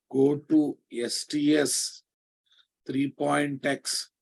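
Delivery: slow, spelled-out steps; slow dictation. Shown as a demonstration. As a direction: dictate each step slowly. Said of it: "dictating steps slowly".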